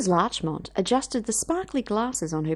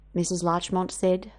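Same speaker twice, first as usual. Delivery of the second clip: ordinary delivery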